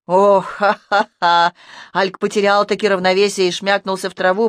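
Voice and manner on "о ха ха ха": slow, loud jeering laugh; mocking laugh. A narrator's reading. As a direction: draw out a scornful ha-ha-ha.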